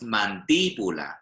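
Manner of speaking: mandíbula with a strong accented DÍ, third syllable from the end; stress on DÍ. Shown as a demonstration. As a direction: punch the DÍ in mandíbula. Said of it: "When the stress comes somewhere else".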